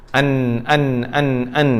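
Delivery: "an" repeated, air sent into the nose; short, softish demonstrations of "an"; nasal nun drill. Shown as a demonstration. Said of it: "ada sikit angin naik ke hidung". (sebut "an" dengan dengung hidung)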